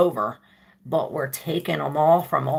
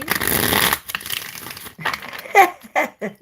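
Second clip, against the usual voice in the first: long breathy giggle; 5 dB louder than usual. Real, not acted. Laughing at someone unseen.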